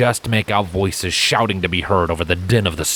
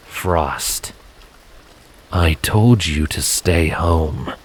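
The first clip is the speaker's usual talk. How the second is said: lower, slow warning; 2 semitones lower, much slower than usual. A narrator's reading. Silent warning Scarlet to back off, low slow warning. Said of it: "in a low, warning tone".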